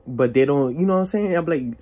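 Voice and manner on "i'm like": ordinary delivery, nothing marked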